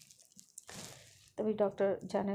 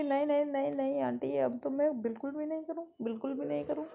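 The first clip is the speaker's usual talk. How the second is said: high, loud hurried promise; pleading child; 6 semitones higher, 4 dB louder than usual. Acted. Lovely promises the doctor aunt she won't misbehave, high pleading. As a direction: beg the aunt like a scolded kid.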